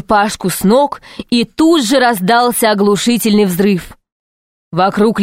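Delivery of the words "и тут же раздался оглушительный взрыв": ordinary delivery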